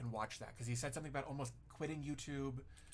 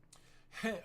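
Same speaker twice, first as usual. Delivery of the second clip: laughing; 8 semitones higher than usual. Real, not acted. Laughs before reading the chat.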